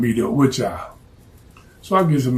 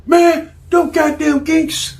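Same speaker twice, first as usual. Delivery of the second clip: higher, slow, garbled put-on voice; mangled rant; 13 semitones higher, 4 dB louder, slower than usual. The target rants at the hitman in a high, slurred voice. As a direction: slur the insults in a strange voice. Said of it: "He said".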